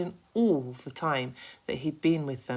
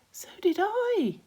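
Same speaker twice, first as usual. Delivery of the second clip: higher ladybird voice; lightly voiced ladybird; 5 dB louder than usual. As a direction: chime in brightly.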